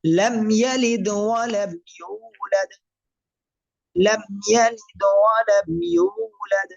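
slow melodic tajweed chant; Quran recitation. Shown as a demonstration. Teacher reciting for students in slow tajweed chant. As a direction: recite slowly, drawing out each vowel.

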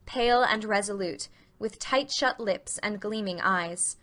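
normal talking voice

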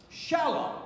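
"Shalom" stressed on SHA, pitched high; wrong-syllable stress. Shown as a demonstration. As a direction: hit the first syllable of "Shalom". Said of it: "if you didn't co-, read it correctly".

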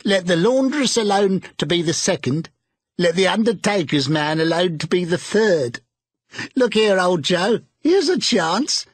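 put-on a sly old woman crowing over the luck; bold charwoman. A narrator's reading. Charwoman figure voiced by narrator.

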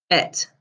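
"et" said with the first sound dropped; jet without the J. Shown as a demonstration. Teacher to pupils: "et", the J dropped. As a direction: say just "et", no J sound. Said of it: "drop the J".

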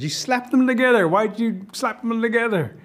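high, indignant rabbit voice; protesting rabbit. Acted. Rabbit protests sore ears.

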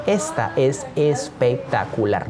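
slow, savoured emphasis; drawn-out hype. Real, not acted. Teacher selling the questions to viewers, slowly emphatic. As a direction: stretch it out syllable by syllable.

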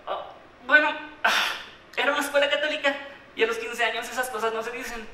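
voz más aguda, imitando al director; director incómodo. Retold or imitated. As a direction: imita a un director apurado que sale del paso. Imita la reacción del director. (imitación de un director incómodo)

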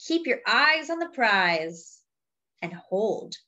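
slow, weighty refrain then lively lines; storybook reading with a slow refrain; reading to children. Acted. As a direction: read the book aloud to the class. Reading picture book to children.

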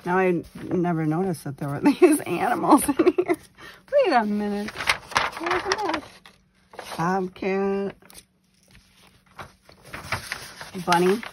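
loud delighted discovery; excited. Real, not acted. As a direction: burst out with delight. Discovering animals in atlas.

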